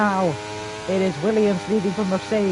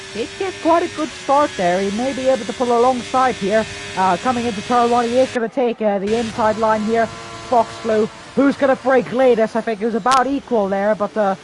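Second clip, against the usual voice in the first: excited commentary; 5 semitones higher, 5 dB louder than usual. Real, not acted. Overtake into turn one, live call.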